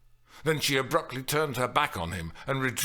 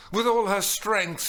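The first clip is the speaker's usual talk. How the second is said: ordinary delivery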